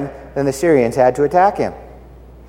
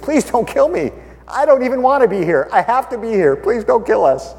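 higher, begging voice; mock-frightened plea, pitched up; pleading commander; 7 semitones higher than usual. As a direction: beg for your life, hammed up a little.

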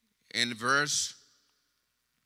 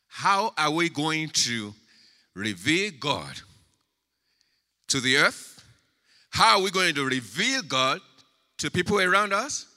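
loud, raised rhetorical question; forceful preaching; 6 semitones higher, 7 dB louder than usual. Acted. Preacher challenging the church on revealing God, forceful preaching. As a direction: press the question out to the congregation, high and loud.

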